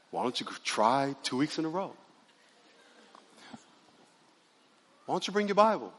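soft, patient imitation of an inner voice; gentle hushed prompting. God nudging a churchgoer days later, softly imitated. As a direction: nudge quietly with an understated suggestion. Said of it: "He's just gonna whisper to you".

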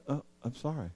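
calm the angry one down; soft apology. Acted.

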